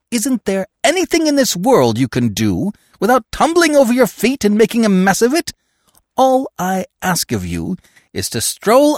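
high, loud exasperated tirade; exasperated scolding. Acted. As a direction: scold a bungling accomplice. Miss Peavy scolds her accomplice.

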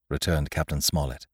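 ordinary delivery, nothing marked

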